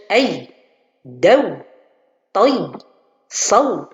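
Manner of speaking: "ay", "daw", "tay", "saw" pressed hard as if doubled; wrong doubling. Shown as a demonstration. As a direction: stress "daw" "saw" as if with shadda. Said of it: "On dirait qu'il y a l'existence de shadda".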